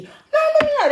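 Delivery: high loud exclamation; exclaiming. Real, not acted. Telling friends a funny story, exclaiming. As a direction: burst out before the story.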